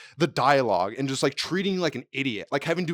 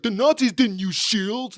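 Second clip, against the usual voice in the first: mock dumb voice; 8 semitones higher, 4 dB louder, much slower than usual. Mocking a dumb movie line.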